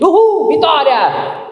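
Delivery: high, loud victory whoop; excited cheer. Pretend. Believer cheering God's yes, high excited whoop. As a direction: celebrate the answered prayer.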